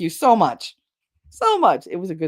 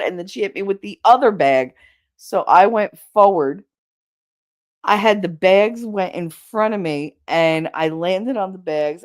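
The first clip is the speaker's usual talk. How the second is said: ordinary talk, nothing marked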